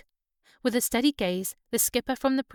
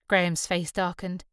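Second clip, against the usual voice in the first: ordinary delivery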